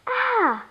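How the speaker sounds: high, short cry of fright; startled cry. Acted. Girl jumps back from the alien, startled cry.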